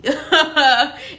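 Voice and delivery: high, pleased laugh; amused laugh. Real, not acted. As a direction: laugh happily at your own line. Amused by "team B B.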